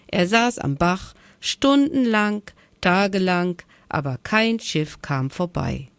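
ordinary delivery, nothing marked

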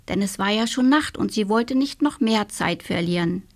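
plain reading voice

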